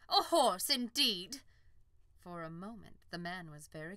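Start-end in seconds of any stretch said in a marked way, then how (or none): 0.1-1.4 loud offended scoff; indignant scorn. Acted. Unicorn to the farmer, loud indignant scoff. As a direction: scoff it in pride. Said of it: "she snorted".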